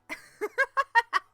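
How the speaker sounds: high, loud burst of laughter; loud cackle. Acted. Mocking a friend's modus, loud high laugh.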